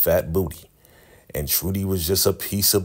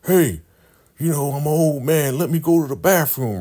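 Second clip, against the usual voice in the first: put-on high, louder wheedling old sheriff; old man voice; 10 semitones higher, 5 dB louder than usual. The sheriff begs the guard, in a high old-man voice. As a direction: play the old man angling for a bathroom break.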